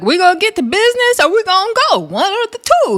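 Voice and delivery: high, quick imitated holler; mock yelling. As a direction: hurry them along in a put-on high voice. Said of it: "Dolly starts yelling".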